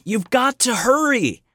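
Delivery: high, urgent begging; pleading. A narrator's reading. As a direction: plead with her to hurry. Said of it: "Jeremy pleaded".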